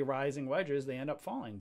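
ordinary talk

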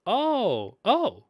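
loud, high surprised "oh". Real, not acted. Co-host hears an unexpected name, surprised. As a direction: burst out with a startled, high oh.

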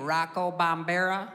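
put-on slow, unsure dumb-girl guess; clueless girl. College girl guessing the president's name, slow and unsure. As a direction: drag out the wrong name, unsure. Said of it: "She goes".

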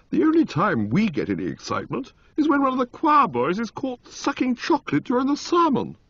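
put-on high, eager young lord; a gleeful toff let loose; Motty voice. A narrator's reading. Motty to Bertie on city temptations, high and eager. Motty on his dull home life.

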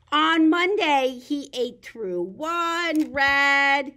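lively picture-book reading; reading to children. Acted. Teacher reads The Very Hungry Caterpillar to kids. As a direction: read it big for little ones.

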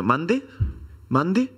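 high, sing-song Mexican "¿Mande?" said twice; mock Mexican accent. Ordering nachos in Mexico, he parrots the waitress in a high mock Mexican accent. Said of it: "me sale hablar con ese acento".

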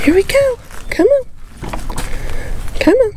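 high coaxing call; calling livestock. Real, not acted. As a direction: coax the animal forward. Calling cattle onto scale.

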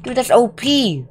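squealing protest; high-pitched outraged complaint. Real, not acted. Gamer telling friend about unfair zombie hits, squealed complaint. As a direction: whine high at how unfair it is.

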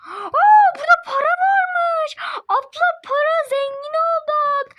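very high, loud gleeful squeal; excited squeal. Acted. Girl finding cash tells her sister, squealing high. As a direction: squeal over the found money. Found money, squealing with joy.

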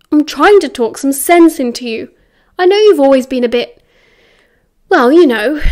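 loud, insistent reasoning; frustrated lecture. A narrator's reading. James trying to talk sense into Sirius, frustrated and loud. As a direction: press the point with frustration.

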